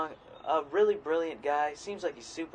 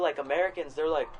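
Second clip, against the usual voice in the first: usual speaking voice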